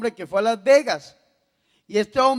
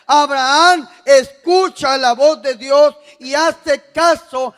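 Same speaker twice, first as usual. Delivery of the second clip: loud, raised preaching voice; full-force preaching; 4 semitones higher, 10 dB louder than usual. Real, not acted. Preacher urging the congregation whom to obey, loud and emphatic. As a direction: drive each phrase home at high volume.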